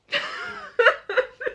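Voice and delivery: sudden high giggle breaking out; real laugh. Real, not acted. Viewer hears her laugh at the sold-out book, high giggle. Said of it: "I'm laughing, but it's not funny".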